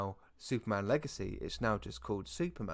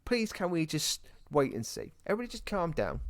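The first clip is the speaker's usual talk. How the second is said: mock exasperated plea; 9 semitones higher than usual. Mock pleading with fans to calm down.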